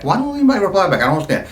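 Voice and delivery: ordinary talk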